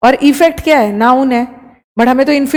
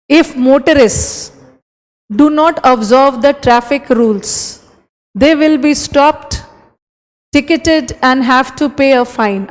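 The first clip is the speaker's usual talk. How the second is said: very slow reading; slow dictation; much slower than usual. Shown as a demonstration. Teacher slowly reading an exam sentence to students. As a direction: read each phrase slowly with pauses. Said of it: "reads exam sentence aloud".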